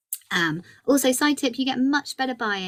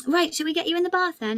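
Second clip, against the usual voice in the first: put-on much higher, bright sing-song coaxing a toddler; playful parent to toddler; cheery mum voice; 12 semitones higher than usual. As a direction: coax your little one into the bath. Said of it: "And I said to her".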